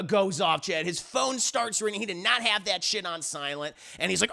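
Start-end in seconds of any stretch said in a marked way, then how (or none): none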